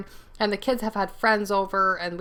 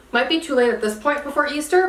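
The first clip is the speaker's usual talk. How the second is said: ordinary talk, nothing marked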